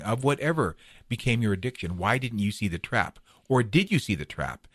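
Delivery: usual speaking voice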